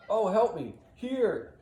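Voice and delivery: high, loud wounded plea; yelling for help. Acted. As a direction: cry out to be found. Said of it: "Roderigo off in the distance yelling".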